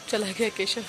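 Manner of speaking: usual speaking voice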